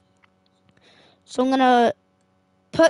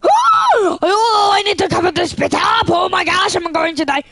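high frightened yelling; panicked shouting; 4 semitones higher than usual. Real, not acted. Gamer cornered in the game, yelling in fear.

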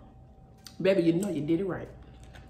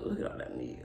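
awed whisper; 2 semitones higher, 10 dB quieter, faster than usual. Real, not acted. Awed at lobster meat.

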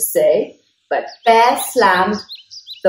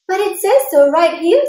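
high quick squeaky insistence; eager mouse voice; 12 semitones higher, 4 dB louder, faster than usual. Acted. Mouse insists to Bear, high and quick. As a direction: Mouse pipes up, much higher and fast. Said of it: "said Mouse".

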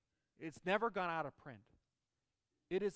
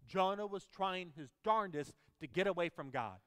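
normal talking voice

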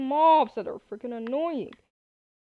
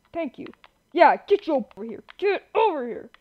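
usual speaking voice

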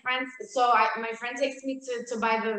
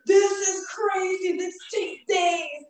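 overwhelmed friend; 7 semitones higher, slower than usual. Retold or imitated. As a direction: act out the friend's despair. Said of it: "She's like".

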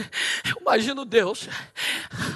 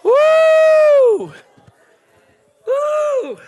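ecstatic high shout; 8 dB louder than usual. Real, not acted.

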